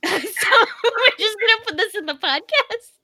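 high laughing disbelief. Real, not acted. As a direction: laugh out the question.

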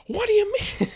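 very high, squeaky indignant question; shrill put-on whine; [name] as the fox. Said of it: "personality of [name]".

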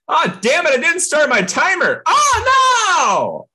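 high, loud hammed-up outcry; mock outcry. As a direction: wail in mock dismay. Timer forgotten outcry.

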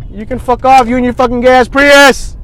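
loud, high angry shout; sudden furious outburst; real road rage. Real, not acted. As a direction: yell it at another driver. Some dude was honking at me.

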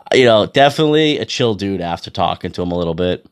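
normal talking voice

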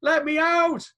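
very high, loud mock yell; imitated voice from coffin. Recorded dead man to his mourners, high mock yelling. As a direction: yell as if shut in a box. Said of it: "a recorded voice going".

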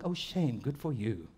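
oh shame, then a low smug good for you; a hint of gloating; mock pity. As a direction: fake sympathy turning to glee. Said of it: "We go like".

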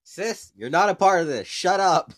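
loud, high snap at the sister; yelling at sister. Real, not acted. Telling off his sister who cut in, loud and high. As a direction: tell her off, loud and high.

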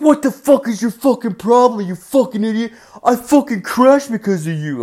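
high-pitched, furious retold rant; angry driver. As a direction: rant like an enraged crash victim, pitch way up. Irate driver at his window.

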